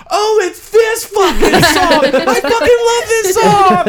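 mock ecstatic fans. Crowd recognizing Back in the High Life, high squealing mock joy. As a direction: squeal it like a thrilled drunk fan.

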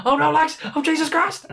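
very high squealing mock panic; hammed-up high panic. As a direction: shriek it like a scared wrestler. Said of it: "He's like".

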